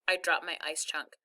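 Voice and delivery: ordinary delivery, nothing marked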